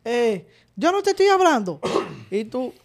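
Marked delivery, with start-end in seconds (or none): 0.8-1.8 high mock scold; imitating a parent. Parent scolding a silent child, high mock scold. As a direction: snap it up high like a strict mom. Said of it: "te decían de que".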